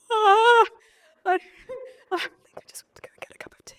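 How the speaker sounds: high yelp, then a fast, breathless, teary whisper; yelp, then rush out a whispered escape; panicked retreat. Retold or imitated. Cornered newcomer answering an intense stranger, a panicked teary whisper.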